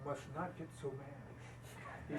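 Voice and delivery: slow, hushed retold caution; soft, drawn-out calming advice; quiet admonishment. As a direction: quote him softly, spacing out the words. Retold partner calms the yelling speaker.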